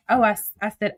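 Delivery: ordinary talk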